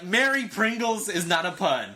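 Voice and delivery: amused, high-pitched exasperation; indignant objection. Acted. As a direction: object to the pun in mock outrage.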